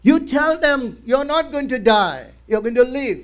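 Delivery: preacher urging the faithful to speak life, forceful; preaching at full force. Acted. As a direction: thunder the promise to the people. Preacher exhorting congregation.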